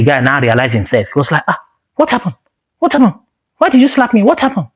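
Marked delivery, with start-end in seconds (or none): 1.5-4.7 high, bewildered questions; confused. Retold or imitated. Friend comes to and asks what happened, bewildered. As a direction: ask like you just woke up lost.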